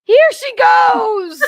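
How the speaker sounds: very high, loud, stretched-out cry; excited shriek. Real, not acted. Host watching the actress rush the stage, shrieking. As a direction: shriek it out as the moment arrives. Crying out as the footage replays.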